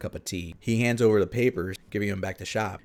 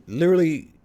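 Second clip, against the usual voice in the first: exasperated; 7 semitones higher, 7 dB louder than usual. Retold or imitated. Saitama exasperated at Genos.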